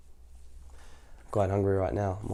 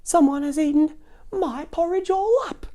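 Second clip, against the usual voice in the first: very high, louder cub voice; squeaky sad baby bear; 4 dB louder than usual. Acted. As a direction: whine it like a little bear. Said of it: "said the baby bear sadly".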